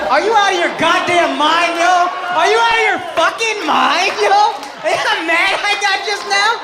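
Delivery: ranting at the crowd in disbelief; incredulous yelling. Real, not acted. Incredulous rant at audience member.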